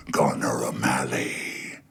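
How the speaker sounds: deep, slow monster voice; rumbling monster. Acted. Ancient tree monster calling the boy by name. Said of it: "Its voice rumbled low and loud".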